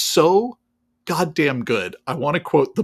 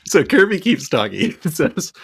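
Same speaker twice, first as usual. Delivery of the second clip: ordinary delivery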